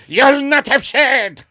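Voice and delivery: loud, high, slow mock German; botched German accent. Shown as a demonstration. Second attempt at the grandfather's order, shouted bad German accent. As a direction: bellow it in a hammed-up German accent. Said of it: "my accents are fuck".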